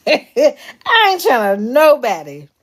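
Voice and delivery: laughs through the words, slowed down; chuckling at her own flop; laughing. Real, not acted. Laughing while telling followers she channeled no one.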